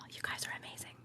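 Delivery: breathy whispered thanks. Real, not acted. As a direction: say it with breath only, no voice.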